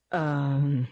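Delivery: stammering. Acted. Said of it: "he stammered".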